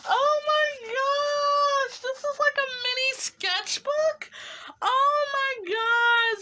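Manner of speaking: high excited exclaiming; ecstatic gushing. Real, not acted. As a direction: shriek with delight at the gift.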